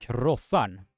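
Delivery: Krossan said slowly on its own, Swedish r; Krossan, clear Swedish vowels; Swedish word demo. Shown as a demonstration. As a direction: model Krossan for the viewers. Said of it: "how you are pronouncing this is".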